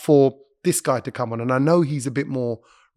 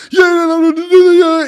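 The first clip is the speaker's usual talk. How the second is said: much higher and louder shouted yeahs; hype shout; 14 semitones higher, 9 dB louder, slower than usual. Shown as a demonstration. Comedian mocking a set with only one energy level, with much higher and louder shouted yeahs. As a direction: blast it like a nonstop hype man.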